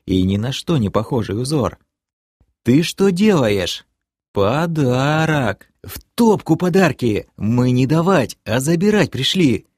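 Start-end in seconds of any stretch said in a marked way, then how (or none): 2.6-3.9 puzzled question. Acted. Narrator asks elf puzzled. 4.3-9.7 hoarse wheeze. Acted. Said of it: "просипел я".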